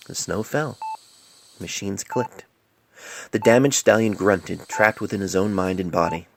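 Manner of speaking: ordinary delivery, nothing marked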